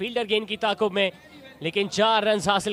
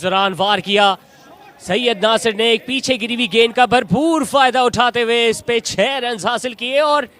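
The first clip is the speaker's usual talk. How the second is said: rising, louder, building to the six; excited cricket commentary; 4 semitones higher, 8 dB louder than usual. Real, not acted. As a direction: call the big hit with rising excitement. Six hit in live commentary.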